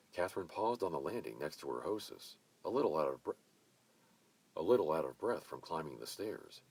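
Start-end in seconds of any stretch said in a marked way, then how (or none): none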